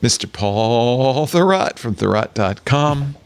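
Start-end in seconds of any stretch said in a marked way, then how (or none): 0.0-1.8 high, slow, grandiose welcome; grand announcement. Acted. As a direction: proclaim it like a ringmaster. Grand mock welcome of hosts.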